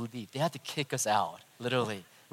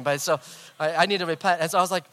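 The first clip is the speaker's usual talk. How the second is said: normal talking voice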